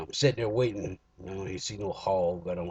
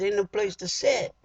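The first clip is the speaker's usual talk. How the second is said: little uproar; 11 semitones higher than usual. Retold or imitated. Griping at the packed AA meeting, high and indignant. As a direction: squawk it up high like you're fed up. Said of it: "I had a little uproar".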